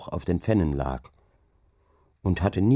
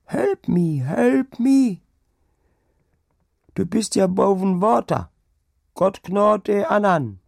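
high, loud desperate cries for help; dying cries; 12 semitones higher, 6 dB louder, slower than usual. Acted. Old woman dying, crying out for help. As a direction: cry out in terror at the water.

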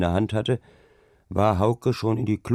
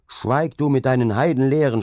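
raised, higher voice cutting in; angry shout; 6 semitones higher, 5 dB louder than usual. A narrator's reading. Hauke silences the carter's heathen talk, angry shout. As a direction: shout down the insolent man. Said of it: "schrien Hauke an".

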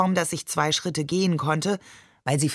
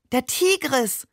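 delighted cry; 7 semitones higher, slower than usual. Acted. Boy spotting the river from the car, delighted cry. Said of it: "rief er entzückt".

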